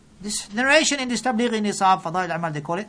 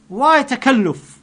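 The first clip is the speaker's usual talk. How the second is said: slow, raised and louder; rebuking question; 5 semitones higher, 8 dB louder, slower than usual. Real, not acted. Preacher scolding excess worship, slow loud rebuke. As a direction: drag it out in rebuke.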